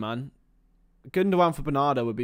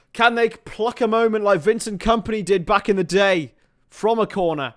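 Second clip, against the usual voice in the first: high, loud excited match commentary; urgent football commentary at full voice; live play-by-play; 6 semitones higher, 5 dB louder than usual. Real, not acted. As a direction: call the chance as it happens, voice raised.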